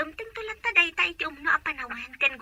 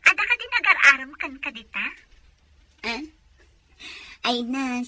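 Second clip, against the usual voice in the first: ordinary talk, nothing marked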